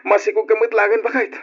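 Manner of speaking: high, loud whoops of joy; overjoyed. Acted. Husband learns his wife is pregnant, overjoyed.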